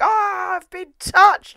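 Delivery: loud, high, drawn-out melodramatic wail; mock horror. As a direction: wail it slowly in hammed-up horror. Mimics overreaction to being touched.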